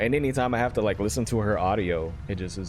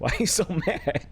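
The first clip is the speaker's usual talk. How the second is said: high, cracking up mid-question; giggly mock complaint; laughing protest; 9 semitones higher than usual. Real, not acted. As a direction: break into laughter while asking. Jokes to aggressive grandma, breaking up.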